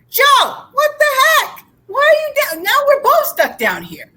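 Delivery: high, loud acted outburst; exasperated shout. Trapped man to Joe who jumped in, exasperated shout. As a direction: yell in hammed-up disbelief.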